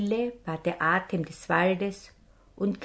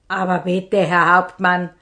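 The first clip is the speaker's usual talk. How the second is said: louder pleading call; 8 dB louder than usual. Acted. Brother cries out to the robber captain, louder. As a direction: call out to stop him. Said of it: "rief der eine Bruder".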